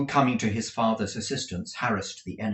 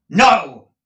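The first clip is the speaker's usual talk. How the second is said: a loud, heavy thundered no; thundering no; 8 semitones higher, 15 dB louder than usual. Acted. Nicholas defies Squeers, a thundering no. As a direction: thunder it back at him. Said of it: "thundered Nicholas".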